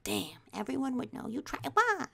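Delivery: quiet disbelief rising to a high "why?"; soft musing that leaps up high at the end; dismayed echo. Retold or imitated. Comic replaying mom's words to self, dismayed.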